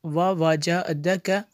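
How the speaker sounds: measured, resonant teaching recitation; word-by-word recitation. Shown as a demonstration. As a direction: chant each word separately with held vowels. (एक-एक लफ़्ज़ लय में पढ़ना)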